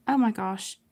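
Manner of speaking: normal talking voice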